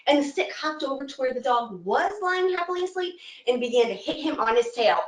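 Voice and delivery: plain reading voice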